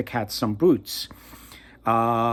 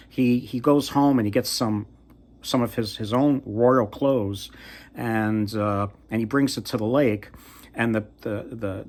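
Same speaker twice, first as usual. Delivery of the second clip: ordinary delivery, nothing marked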